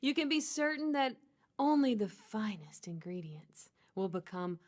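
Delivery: seduce the listener with a carrot bag; sexy voice. Pretend. Reads the carrot bag to her co-host as a sexy hotline voice. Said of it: "I'm gonna read it in my sexy voice".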